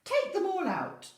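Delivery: put-on high and loud old man voice; squeaky command from the old man; wise old man. A narrator's reading. Wise old man ordering the animals out, high and loud. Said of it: "said the wise old man".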